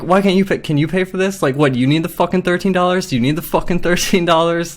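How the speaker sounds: retold angry demand, pitched high; getting pissed. Customer retelling how she pressed the manager, getting pissed. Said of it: "I was, like, getting pissed".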